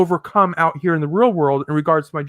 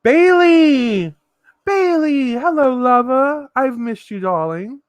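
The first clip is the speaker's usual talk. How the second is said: breathy vintage purr; 6 semitones higher than usual. Pretend. Greets a viewer in a put-on voice.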